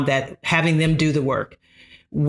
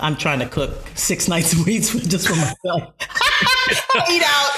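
laughing; 3 semitones higher than usual. Real, not acted. Joking about her own cooking.

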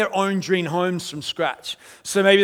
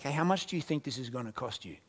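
much softer and lower; mock question; 5 semitones lower, 12 dB quieter than usual. Imagined church member asking a new believer, hushed. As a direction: toss it off in a hush. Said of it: "and you're like".

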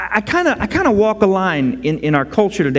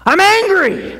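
much higher and louder, blurting it out; mock outburst of anger, high and loud; acted anger; 11 semitones higher, 4 dB louder, slower than usual. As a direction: burst out with it at God. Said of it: "If you're feeling angry at God".

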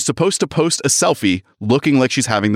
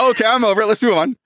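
much higher, airily brushing it off; mock breezy dismissal; 11 semitones higher, faster than usual. Character shrugging off an investigation, mock breezy. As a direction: act out someone blithely moving on.

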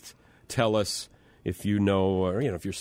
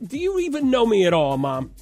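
exasperated; 9 semitones higher, 4 dB louder, faster than usual. Retold or imitated. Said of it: "I'm like".

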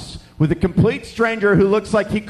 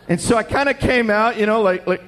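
ordinary delivery

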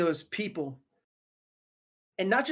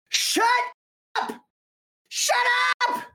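comic screamed outburst, broken into bursts; mock screaming; 13 semitones higher than usual. Pastor jokes Jesus lost patience with the critics, mock screaming. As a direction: scream it in exaggerated exasperation. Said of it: "Jesus in that moment started screaming".

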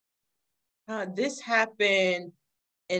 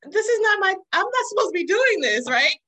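panicked inner voice; 11 semitones higher, 4 dB louder, faster than usual. Retold or imitated. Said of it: "I was just like".

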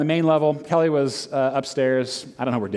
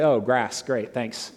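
sarcastic; 7 semitones lower, 3 dB quieter, slower than usual. Retold or imitated. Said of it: "they infuriate me 'cause it's like".

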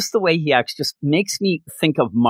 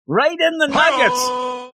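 much higher, slow shout of right in the nuggets; mock shout; 11 semitones higher, slower than usual. Shown as a demonstration. Reviewer repeats the kick for laughs, high slow shout. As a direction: yell it out for comic pain.

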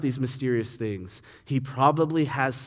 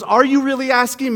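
higher, louder pointed question back; Jesus answering Pilate with a searching question; Jesus probing; 10 semitones higher, 7 dB louder than usual. Retold or imitated. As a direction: turn the question on him. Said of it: "Jesus goes".

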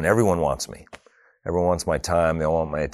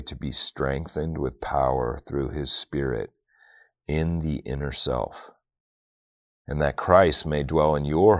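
prayerful scripture reading; 2 semitones lower than usual. Acted. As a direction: pray it slowly and reverently over the congregation.

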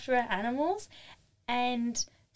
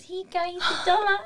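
lightly voiced child, higher and louder; a hint of a proud little kid; daughter's voice; 7 semitones higher, 5 dB louder than usual. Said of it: "So Marley walked up to the guy and goes".

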